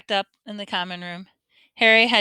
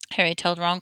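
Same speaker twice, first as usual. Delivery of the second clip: ordinary delivery, nothing marked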